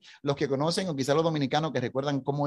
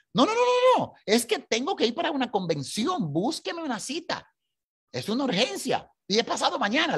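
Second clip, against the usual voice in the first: much higher, insistent rapid pleading; urgent pleading; 8 semitones higher than usual. Retold or imitated. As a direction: beg the clerk, it's urgent. [name] pleads with consulate.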